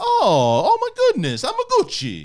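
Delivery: very high, loud cartoon Italian accent. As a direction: ham up an over-the-top Italian voice. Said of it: "He's Luigi in that".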